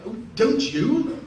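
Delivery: slow, high, drawn-out mock; mock languid O'Toole. O'Toole teasing his co-star, slow and high. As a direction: tease him slowly like a stoned star. Peter mocking him.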